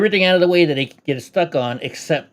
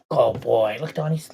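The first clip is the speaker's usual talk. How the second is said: normal talking voice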